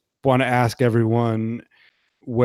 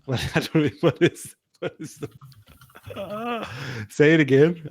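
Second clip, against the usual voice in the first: high, giggly talk through laughter; laughing; 6 semitones higher, much faster than usual. Real, not acted. Laughing over the mangled word.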